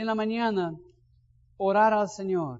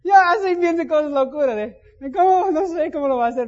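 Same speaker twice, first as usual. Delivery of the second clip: much higher, louder, laughing exclamation; excited shout; 8 semitones higher, 8 dB louder than usual. Real, not acted. Preacher laughs at his own money fantasy, high excited shout. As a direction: burst out at how wild the idea is.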